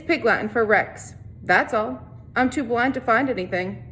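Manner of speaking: steady read-aloud narration for a class; story narration. A narrator's reading. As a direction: read the chapter to the students, evenly. Teacher reads novel to class.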